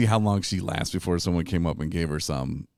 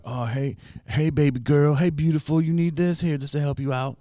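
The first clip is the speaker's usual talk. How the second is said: higher, louder coaxing dealer voice; sleazy dealer; 9 semitones higher, 5 dB louder than usual. Retold or imitated. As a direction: lure her in, falsely sweet. Dealer luring a sick girl.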